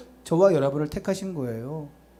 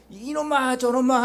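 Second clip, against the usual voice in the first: drawn-out, raised-pitch mock scolding; mock cursing; 5 semitones higher, slower than usual. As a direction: imitate a road-rage curse, stretched out. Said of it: "하면서 욕하고 막 싸우면서". (욕하며 싸우는 흉내로 길게)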